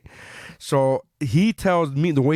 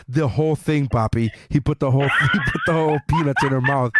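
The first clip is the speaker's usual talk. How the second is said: normal talking voice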